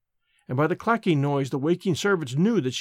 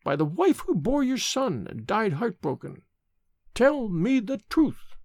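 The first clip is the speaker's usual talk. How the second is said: put-on solemn old nurse; 5 semitones higher than usual. A narrator's reading. Nurse's second oath.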